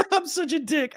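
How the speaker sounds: very high, real giggly squeal; laughing. Real, not acted. Host scolding themself after mocking a viewer, laughing. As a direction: admit it while still cracking up. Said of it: "I'm sorry for laughing".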